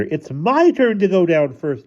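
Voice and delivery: put-on high, loud child's demand; children squabbling. A narrator's reading. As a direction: call out high and loud, claiming your turn. Children quarreling in upper hall.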